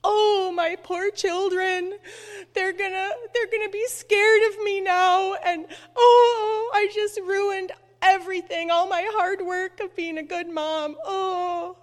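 high, whiny wail of self-blame; mock crying. Mom fretting over her children after her tantrum, mock crying. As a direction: wail it in a hammed-up tearful voice. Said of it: "stomping around and crying".

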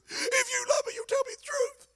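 high, whiny falsetto Delilah begging; pleading Delilah. Retold or imitated. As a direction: beg like a hurt lover, way up high. Preacher voices pleading Delilah.

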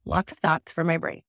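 ordinary talk, nothing marked

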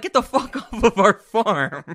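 hammed-up shout through a laugh; imagined angry reaction. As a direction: yell it in mock outrage, breaking into laughter. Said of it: "The reaction would be like".

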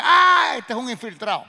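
loud, high accusing cry of a soldier; suspicious soldier shout. Retold or imitated. Soldier checking a passport shouts at him. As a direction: bark it out as if catching a spy.

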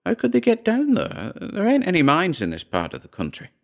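high-pitched puzzled woman; Dora's voice. A narrator's reading. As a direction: question him in a high character voice. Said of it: "said Dora".

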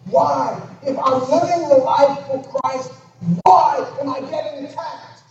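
high, loud pleading cry of why; impassioned shout. Acted. Preacher crying out a believer's why, loud and high. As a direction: shout the question out like a wronged believer.

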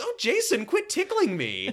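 high-pitched mock girlish squeal; giggly girl imitation. Girl being tickled during a phone call, high playful protest. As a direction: draw out a playful high complaint. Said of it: "this girl starts giggling".

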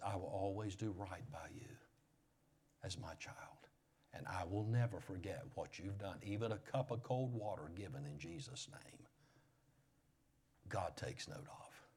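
soft whispered words of God; hushed whisper. Acted. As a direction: whisper it like a tender promise. God's promise, hushed.

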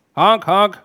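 loud, high double honk; glad honking. Acted. Otto munches books and ignores Topper, glad honking. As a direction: honk happily like a rhinoceros. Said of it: "udstødte det ene glade honk".

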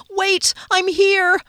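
high, loud yell over propellers. Acted. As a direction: yell to be heard over engines. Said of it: "I had to yell over the noise".

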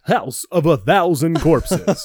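slow, loud, booming trailer voiceover; movie trailer announcer voice. Shown as a demonstration. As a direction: announce House of a Thousand Corpses like a horror trailer. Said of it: "any kind of voiceover saying the name".

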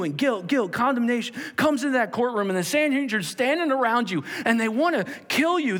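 high, loud preaching; fiery preaching. Acted. Preacher describing the courtroom to congregation, fiery and loud.

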